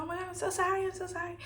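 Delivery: high, soft gushing sorry; imitated apology. Friend apologizing for spilling a drink on him, imitated apology. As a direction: mimic a flustered friend in a small high voice.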